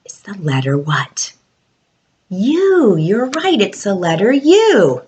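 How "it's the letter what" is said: soft unvoiced whisper, inviting the answer; whispered prompt. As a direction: lean in and whisper the question. Teacher prompting children quietly.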